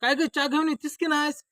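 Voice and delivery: high, loud demand; impatient call. Acted. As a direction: holler at the wife to hurry. Impatiently calls wife for tea. (मोठ्याने अधीर हाक)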